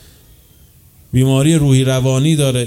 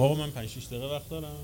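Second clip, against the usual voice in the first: fast, much softer side question; quick quiet aside; 14 dB quieter, much faster than usual. Real, not acted. Preacher quickly asking the host how much time is left, hushed and rushed.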